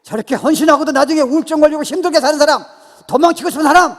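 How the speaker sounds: high, sustained shouting cadence; full-force preaching. Real, not acted. Preacher declaring whom he can awaken, full-force shout. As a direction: shout each kind of person out.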